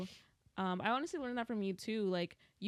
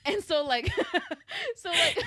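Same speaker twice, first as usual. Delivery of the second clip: laughing too hard to talk; words broken by loud laughter; 10 dB louder than usual. Real, not acted. Laughing at own anxiety story.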